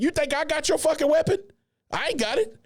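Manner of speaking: high mocking drill sergeant.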